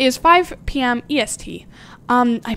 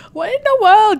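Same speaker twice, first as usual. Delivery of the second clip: higher, punchier second go, baffled; exasperated outburst; 8 semitones higher, 5 dB louder than usual. Real, not acted. Streamer can't find the elf, exasperated outburst. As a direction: throw it up in mock outrage. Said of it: "You're making me so mad".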